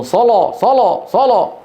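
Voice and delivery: a retold street crier calling صلاة; imitating street callers. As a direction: mimic men calling people to prayer in the street. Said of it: "فكانوا يدورون في الشوارع يقولون".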